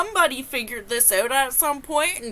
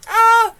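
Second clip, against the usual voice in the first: loud shriek; 11 semitones higher, 12 dB louder than usual. Real, not acted. Shriek of disbelief.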